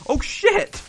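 sudden high loud curse; shocked high exclamation; startled yelp. Real, not acted. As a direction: jump at the surprise hit. Shot by skeleton.

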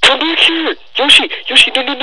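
high panicked shout; yelling at game. Real, not acted. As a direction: shout desperately at the screen.

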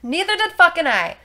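high, loud outburst; loud exclaim. Real, not acted. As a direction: blurt it out, shocked. Shocked reply about a milk bubble.

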